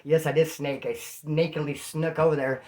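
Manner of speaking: ordinary delivery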